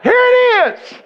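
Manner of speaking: excited reveal to the audience; excited announcement. Real, not acted. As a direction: announce the big moment. Building up to the story climax.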